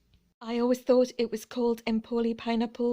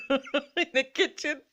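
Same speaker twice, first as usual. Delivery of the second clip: higher, slower, through giggles; laughing; 6 semitones higher, slower than usual. Real, not acted. Vlogger admitting to messy kitchen spices, laughing. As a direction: finish the confession in a laugh.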